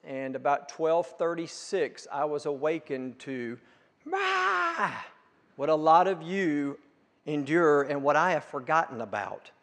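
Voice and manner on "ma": put-on high, childlike cry of Ma; toddler crying Ma. Pastor imitates his grandchild waking at night, high childlike call.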